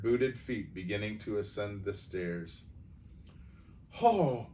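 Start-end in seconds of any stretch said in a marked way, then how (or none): 3.9-4.5 high, voiced cry of alarm; frightened stage whisper. A narrator's reading. Aunt hears boots on the stairs, frightened stage whisper. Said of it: "Aunt Gladys whispered".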